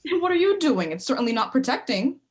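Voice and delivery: heated comeback in an argument; angry retort. Acted. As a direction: fire the accusation back loudly. Acted quarrel, throws accusation back.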